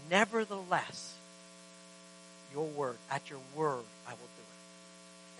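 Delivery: slow, falling to a near whisper on "I will do it"; hushed surrender. Retold or imitated. Believers surrendering to the Lord, slow near whisper. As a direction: let it fade into breathless yielding.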